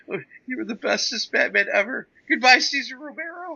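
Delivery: high, loud silly farewell; mock zombie farewell. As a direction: wail a dopey goodbye. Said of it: "by the zombie".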